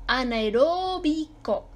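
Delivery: a-na-e-RÓÓ-bi-co, slow demonstration; stressed RÓ. Shown as a demonstration. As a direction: stretch RÓ in Anaeróbico, then bi-co apart. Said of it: "esdrújula".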